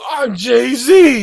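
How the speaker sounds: high shouted reveal; mock shout. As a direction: yell it like a big reveal. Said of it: "it goes".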